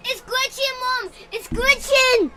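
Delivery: loud, high calling out; excited shout. Real, not acted. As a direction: yell it to mom across the room.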